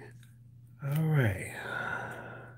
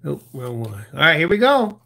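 ordinary delivery

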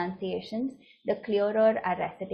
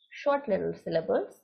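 ordinary talk, nothing marked

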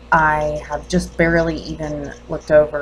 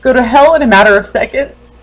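raised, higher-pitched accusing questions; bereaved mother confronting doctor; 5 semitones higher, 8 dB louder than usual. Acted. Mother demands whether doctor saw her life go to hell, accusing. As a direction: challenge the doctor with rising hurt and anger.

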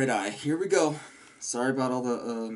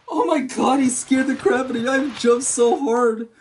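real shaken voice, higher and louder, close to crying; 10 semitones higher, 7 dB louder than usual. Real, not acted. Player tells viewers how badly he jumped, shaken and near tears. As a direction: still reeling from the scare. After a jump scare.

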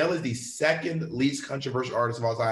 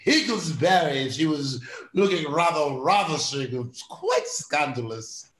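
put-on slow, stretched aristocratic English accent; posh British parody; slower than usual. Gossiping about a ravishing Duchess, posh British parody. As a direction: savour each word like a scandalized British aristocrat. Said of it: "Does anyone really talk like that?".

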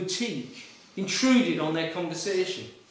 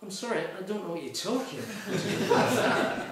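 stifled laugh; 4 dB quieter than usual. Retold or imitated. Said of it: "She said".

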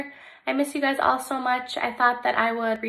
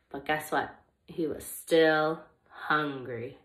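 lively, expressive storybook reading; reading to children; 5 semitones lower, 6 dB louder, slower than usual. Acted. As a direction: read it like story time for little kids. Reads picture book to children.

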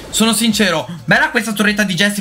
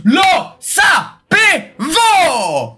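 triumphant cheer; 9 semitones higher, much slower than usual. Real, not acted. Streamer celebrating the legendary egg with viewers, triumphant cheer. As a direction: celebrate winning the bet.

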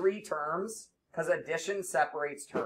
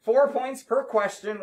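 raised, higher voice calling across the room; calling out to class; 6 semitones higher, 7 dB louder than usual. Real, not acted. Teacher calls students to the board, raised calling voice. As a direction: call the student up loudly, fast.

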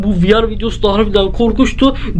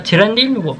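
ordinary delivery

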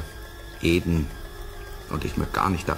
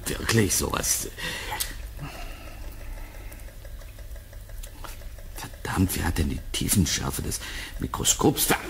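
muttering to himself; 5 semitones lower, 6 dB quieter than usual. Acted. Alone, muttering at microscope.

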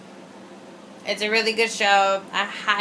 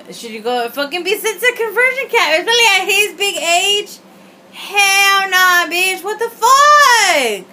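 higher, louder outraged rant; 10 semitones higher, 6 dB louder than usual. Real, not acted. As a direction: let the outrage build to a yell. Outraged rant about conversion camp.

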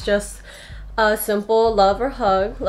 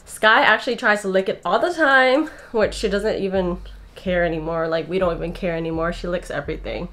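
ordinary talk

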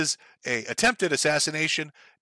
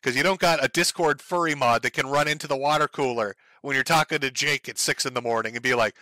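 usual speaking voice

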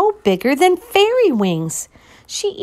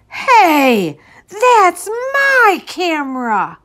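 put-on indignant boy; 7 semitones higher, 4 dB louder, slower than usual. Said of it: "Martin said".

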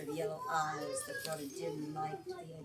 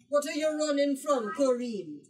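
louder, a touch of a booming papa; father's voice; 6 semitones higher, 7 dB louder than usual. Acted. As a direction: call out like a hearty father. Father finds startled daughter.

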